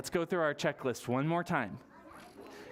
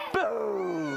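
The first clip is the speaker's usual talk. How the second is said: loud playful boo; spooky boo; 5 semitones higher, 4 dB louder than usual. Pretend. Librarian jumps out at the children with a loud boo. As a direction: jump out at them with a boo.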